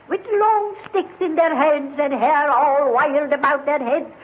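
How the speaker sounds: ordinary delivery, nothing marked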